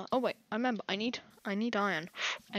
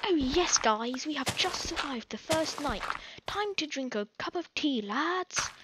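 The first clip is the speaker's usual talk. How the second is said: loud, high, hammed-up English accent; exaggerated tea-drinking English lad accent; terrible English accent; 5 semitones higher, 6 dB louder than usual. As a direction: put on a bad English accent, celebrating. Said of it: "That was a terrible English accent".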